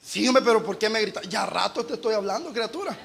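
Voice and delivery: lightly voiced distracted man; distracted mumble. Distracted man answers softly.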